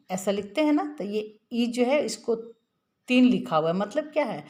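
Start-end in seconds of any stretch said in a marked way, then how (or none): none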